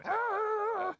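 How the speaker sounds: high, loud Yoda death scream; death cry. Pretend. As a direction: let out a piercing dying scream. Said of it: "Do a Yoda death scream".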